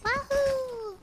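high whooping victory cheer; excited cheer. Real, not acted. Celebrating winning the round.

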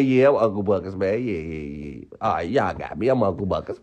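low, resigned mock confession; confessing suspect. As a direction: imitate a suspect giving up, voice sunk low. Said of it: "I'm gonna go in there".

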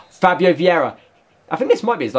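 ordinary talk, nothing marked

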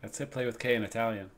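ordinary talk

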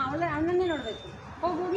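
normal talking voice

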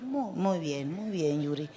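low, soft, slow doctor voice; calm doctor. Retold or imitated. Comedian mimics her gynecologist, low, soft and calm. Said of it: "mi ginecólogo es así, como que bien tranquilo".

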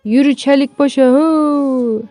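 high drover's cry; herding call. Acted. Driving the ox on.

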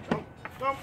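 whispered come come; hushed coaxing call. Real, not acted. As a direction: whisper the dog over.

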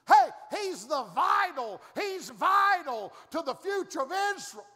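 shouted, high preaching build; fiery preaching. Acted. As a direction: hammer it home from the pulpit. Preaching at full force.

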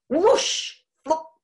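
sound-effect splutters of going under; spluttering. A narrator's reading. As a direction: splutter like a man dunked in icy water. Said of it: "spluttered the chicken farmer".

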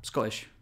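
Scottish with a glottal stop for the TT; swallowed double T. Shown as a demonstration. Repeating the lesson, Scottish with T's swallowed. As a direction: run Scottish together without its T's. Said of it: "just skip your double T's".

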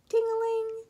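high ringing ting-a-ling; sing-song, high-pitched bell; bell imitation. A narrator's reading. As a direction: chime it like a bike bell.

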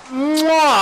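loud smacking chef's kiss. Acted. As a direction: kiss your fingertips like a proud chef. Chef kisses finished burger.

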